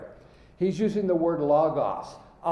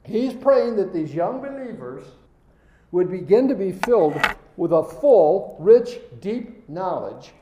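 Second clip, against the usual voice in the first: higher, louder preaching build; rising preaching; 4 semitones higher, 4 dB louder than usual. Acted. Preacher pressing home the meaning to the class. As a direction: build the point with fervor.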